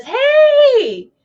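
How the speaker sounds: imitating the cruise line's cheery announcement; mock cheerful. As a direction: chirp it like a smug company. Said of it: "and Norwegian says".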